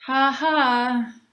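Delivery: loud jeering ha ha; mock laughter. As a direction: laugh at the clumsy girl. Cafeteria kids laughing at her.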